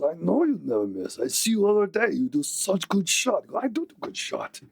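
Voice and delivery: goofy high orc flattery; supportive orc. Acted. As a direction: praise your buddy like a dim sweet orc. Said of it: "The other one goes".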